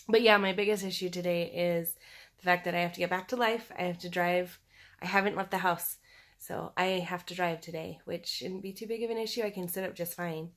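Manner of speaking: usual speaking voice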